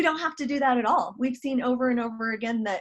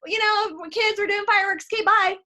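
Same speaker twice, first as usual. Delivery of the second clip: much higher, breezy brush-off; self-absorbed jerk; 9 semitones higher, 5 dB louder than usual. Retold or imitated. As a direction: wave them off like a self-centred jerk. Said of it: "what kind of jerk would I be".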